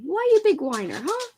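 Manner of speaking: high, playful doggy talk; high cooed question ending in a squeaky huh; teasing a pet. Real, not acted. Teasing her dog.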